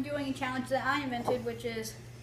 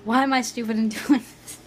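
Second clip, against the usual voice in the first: laughing; 6 dB louder than usual. Shown as a demonstration. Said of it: "Ask that same question again, but right to the camera".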